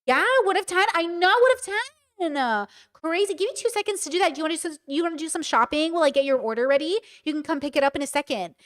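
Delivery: high, quick customer-service chatter. Acted. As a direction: put on a high-pitched, chirpy service voice. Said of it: "customer service voice".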